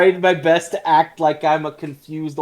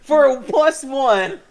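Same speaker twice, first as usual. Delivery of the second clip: excited; 10 semitones higher, 5 dB louder, slower than usual. Real, not acted. Cheering a good roll.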